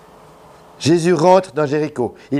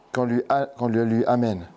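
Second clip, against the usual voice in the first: usual speaking voice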